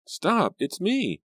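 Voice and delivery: put-on high frantic girl's voice; panicked girl. A narrator's reading. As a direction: call her to stop, high and urgent. Grace running toward Reva.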